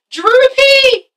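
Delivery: high, loud shout of recognition; excited realization. Real, not acted. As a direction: cry out the name as it comes to you. Suddenly remembers the cartoon's name.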